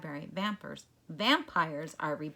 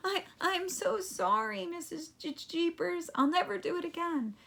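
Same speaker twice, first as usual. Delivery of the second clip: put-on whimpering boy; 11 semitones higher than usual. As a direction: plead like a scared kid. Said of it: "Eddie whimpered".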